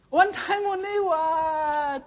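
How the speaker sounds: slow, high Singlish mockery. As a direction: drag out the excuse mockingly. Mocking the excuse, slow.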